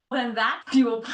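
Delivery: usual speaking voice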